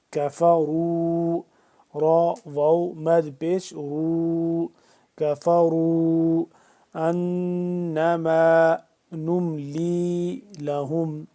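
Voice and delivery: formal Quranic recitation. Shown as a demonstration. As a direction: recite with full tajweed, drawn out. Recitation with spelling drills.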